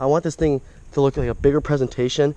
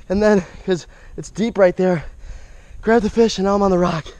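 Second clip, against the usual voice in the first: winded, pitched up from exertion; out of breath; 5 semitones higher than usual. Real, not acted. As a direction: catch your breath after clambering down. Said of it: "I'm out of breath".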